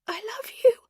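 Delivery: unvoiced, barely there; faint whisper. Dying Caroline to Ro, faint whisper. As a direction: breathe it out weakly. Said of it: "she tried to say".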